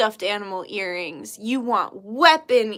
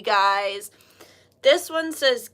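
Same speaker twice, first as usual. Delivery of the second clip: usual speaking voice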